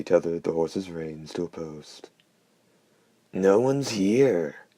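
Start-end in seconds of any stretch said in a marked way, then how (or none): 3.3-4.7 high, loud, very slow hoarse call. Acted. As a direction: drag it out, rasping. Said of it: "the hoarse voice was heard to say".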